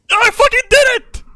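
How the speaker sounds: very high, loud victory scream; triumphant shout. Real, not acted. Triumphant outburst after success.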